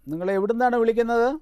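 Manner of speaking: high, projected phone question. Real, not acted. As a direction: lift your voice so the caller catches it.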